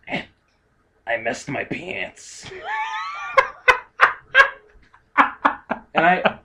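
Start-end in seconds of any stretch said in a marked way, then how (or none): 0.1-2.5 put-on low, soft and slow, a frail old woman; imitating the old lady leaning in close; old lady confession. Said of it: "our old ladies sound almost exactly alike". 2.5-4.5 high burst of laughter; real laughing fit; big laugh. Real, not acted. Laughing at the story.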